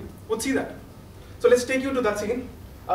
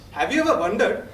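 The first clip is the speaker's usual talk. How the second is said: normal talking voice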